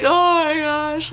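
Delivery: high, slow dread; retold scared gasp of words, drawn out. Daughter caught by her mom, mildly scared. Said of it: "I was so scared".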